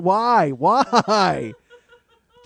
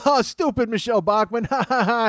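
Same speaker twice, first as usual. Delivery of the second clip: higher, louder jeering laugh; mocking laughter; 6 semitones higher, 4 dB louder than usual. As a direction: laugh at her like a smug crowd. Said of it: "everybody's like".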